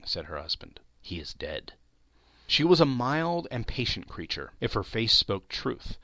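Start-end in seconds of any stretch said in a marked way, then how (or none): 1.0-1.7 very soft, low, near whisper; grave hush. A narrator's reading. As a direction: break the death news in a hush. Breaking news of a death.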